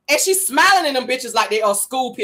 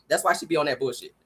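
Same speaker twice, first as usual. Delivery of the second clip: usual speaking voice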